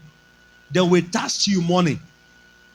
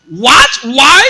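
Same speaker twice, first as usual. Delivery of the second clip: loud, higher indignant what; surprised scolding; 7 semitones higher, 10 dB louder than usual. Retold or imitated. Boss scolding the pastor for missing conference, loud surprised. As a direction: bark out the question in disbelief.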